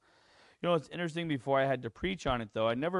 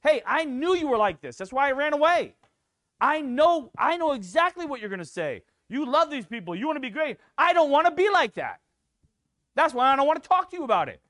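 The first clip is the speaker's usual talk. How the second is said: high, loud sulking complaint; much higher, louder self-pitying rant; Jonah's pity party; 12 semitones higher, 11 dB louder than usual. Retold or imitated. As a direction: whine like a runaway prophet. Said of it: "Just like Jonah was saying".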